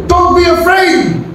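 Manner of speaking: high, slow, commanding call; Moses rallying the people. Acted. Preacher as Moses calling out to the frightened people, slow and high. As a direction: lift the people with a bold command. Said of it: "But Moses said to the people".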